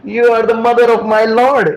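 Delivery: retold flustered protest, pitched high; high, alarmed exclamation, acted out; freaking out. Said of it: "Advaitacharya freaks out".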